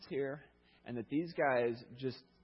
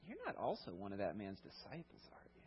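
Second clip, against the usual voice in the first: sarcastic question; 4 semitones lower, 10 dB quieter than usual. Retold or imitated. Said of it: "a question of sarcasm or cynicism or disgust".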